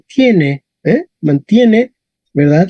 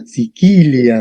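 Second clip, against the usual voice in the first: "Sicilia" lower, as si-KI-li-am with hard k; stress on KI; 6 semitones lower, much slower than usual. Shown as a demonstration. Teacher modelling for the class, stress on KI in "Sicilia". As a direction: repeat "Sicilia" leaning on a long KI. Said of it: "correcto, en qui".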